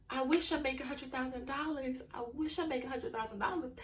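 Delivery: soft, wistful, half-hearted wishing; mock wishful whining. Shown as a demonstration. To her followers, mocking a soft wishful sigh. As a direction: sigh it out like a hopeless daydream. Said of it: "Don't wake up and be like".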